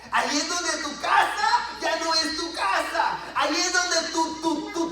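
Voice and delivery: loud, high preacher's cry; full-force preaching. Real, not acted. Preacher demanding total surrender to the Lord, full-force preaching.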